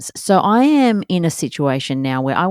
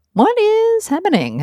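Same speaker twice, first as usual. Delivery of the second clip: much higher, slow bewildered "What is happening?"; puzzled nanny; 3 dB louder, slower than usual. Retold or imitated. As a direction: imitate the confused nanny. Nanny arriving to screaming.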